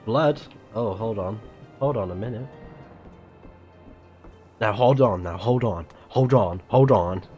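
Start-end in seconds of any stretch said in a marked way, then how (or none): none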